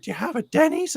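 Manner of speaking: high-pitched, curious put-on voice; eager fan impression. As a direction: ask eagerly in a squeaky-high mimicking voice. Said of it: "this is literally how he's, uh, how he's speaking".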